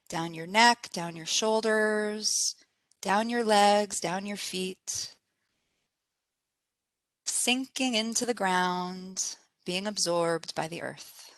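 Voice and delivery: soft, unhurried meditation voice with spaced phrases; guided meditation. Acted. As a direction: guide them gently into relaxation, pausing between phrases.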